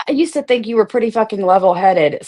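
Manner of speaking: ordinary talk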